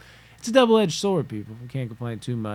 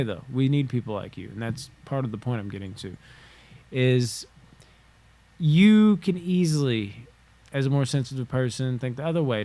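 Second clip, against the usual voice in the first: ordinary talk, nothing marked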